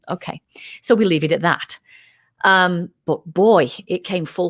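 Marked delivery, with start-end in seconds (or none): 0.1-0.4 much softer, a private thought; quiet inner thought. Retold or imitated. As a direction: say it under your breath, to yourself. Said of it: "so I thought".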